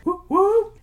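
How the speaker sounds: high loud whoop; cheering. Real, not acted. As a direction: cheer like a party. Celebratory cheer.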